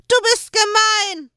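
put-on high, loud wailing child; crying child. A narrator's reading. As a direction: wail it through sniffles. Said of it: "der Gerettete heulte und schniefte".

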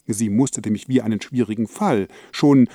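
ordinary delivery

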